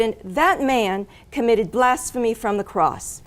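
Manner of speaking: ordinary talk, nothing marked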